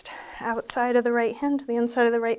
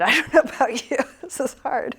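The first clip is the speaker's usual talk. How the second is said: breathless laughing through the words; chuckling while winded; 3 semitones higher, 7 dB louder than usual. Real, not acted. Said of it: "I'm winded".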